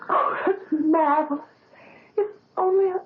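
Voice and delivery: overjoyed, close to crying; tearful joy. Acted. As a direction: voice jumps up high, near tears. Ilse hears Franz will play.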